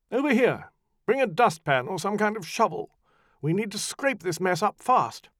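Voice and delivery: loud, high commands to a servant; ordering the servant about, loud and curt; barked orders. Acted. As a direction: order him over sharply. Orders to manservant.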